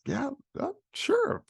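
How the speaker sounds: squeaky, very high-pitched agreement; mock-hesitant yes, voice shooting way up; acted eager yes. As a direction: reenact a yes in a tiny high voice. Acts out his eager, startled acceptance.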